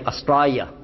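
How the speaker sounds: "Australia" said the Aussie way, R dropped, vowels stretched; Australian pronunciation. Shown as a demonstration. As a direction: demo "Australia" as a lightly voiced Australian would. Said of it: "like this".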